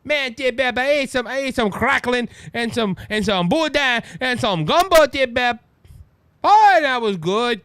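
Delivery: exaggerated mock Cajun; Kamala Harris. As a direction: rattle off food in fake Cajun, relishing it. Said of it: "try to make up a Cajun accent".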